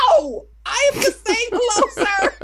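high, laughing put-on sweet voice; mock flirty wife. Host mocks the wife greeting her husband, laughing. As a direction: play coy, laughing through it. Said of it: "she's like".

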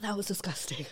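whispered after a harsh shot; breathy unvoiced whisper, grimacing. Real, not acted. Just took a Fireball shot.

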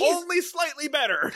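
sing-song sarcastic show moral; mock announcer voice. Repeats mock voice.

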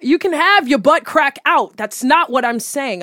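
mock-exasperated, punchy comeback; emphatic retort. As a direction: push back loudly at a critic. Acted retort to imagined critic.

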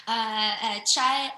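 put-on hesitant, loud stab at a Yorkshire accent; attempted Yorkshire accent. American guest copies English host, attempted Yorkshire accent. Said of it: "try and replicate how I speak".

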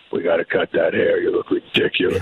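low, gruff father voice; a deep disapproving dad at the dinner table; stern father. Retold or imitated. Father scolding his son at the table, in a low gruff voice. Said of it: "my father would stare at me".

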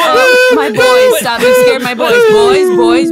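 put-on high, loud, slow wailing for her boys; distressed cry. Yogi wailing to her startled water boys, high and loud.

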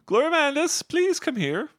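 high, loud call to another room. Acted. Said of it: "she kind of yells to the other room".

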